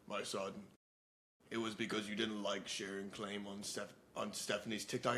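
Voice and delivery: low, soft, solemn pretend deity; God. God explains to a newcomer in heaven, lightly acted, low and soft. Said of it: "And he'd go".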